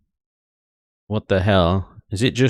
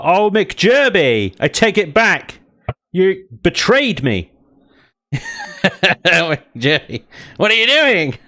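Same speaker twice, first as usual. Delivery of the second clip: higher, hammed-up wounded cry at McJerby; mock betrayal; 8 semitones higher, much slower than usual. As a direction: play the betrayed friend, over the top. Streamer mock-betrayed by chat.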